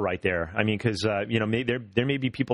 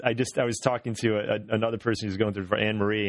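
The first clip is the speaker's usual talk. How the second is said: ordinary talk, nothing marked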